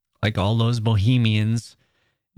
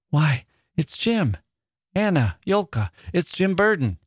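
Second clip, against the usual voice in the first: rising, higher joyful cry of recognition; 7 semitones higher than usual. Acted. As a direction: call out in delighted surprise. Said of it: "She caught her breath".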